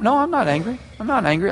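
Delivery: high, controlled insistence through gritted teeth; gritted-teeth denial. Retold or imitated. Person insisting they're not angry, gritted-teeth denial. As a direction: deny it tightly, pitch lifting. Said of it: "they're gritting their teeth".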